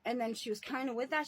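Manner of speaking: normal talking voice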